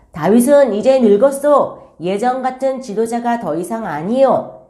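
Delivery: lightly voiced henchman stirring people up; rumour-spreading henchmen. Absalom's men spreading word against David, mildly acted rumour-mongering. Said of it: "소문을 내면서 다녔을 거예요".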